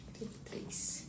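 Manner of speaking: whispered number read to herself. Real, not acted. Host reading the card number to herself, whispered. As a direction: breathe the number under your breath.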